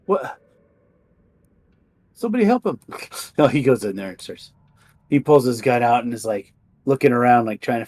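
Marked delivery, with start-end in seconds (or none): 0.1-2.8 higher, urgent call for help; alarmed cry. Acted. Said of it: "he's like".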